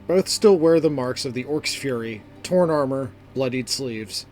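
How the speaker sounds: plain reading voice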